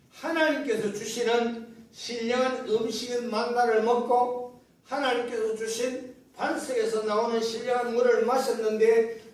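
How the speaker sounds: ordinary delivery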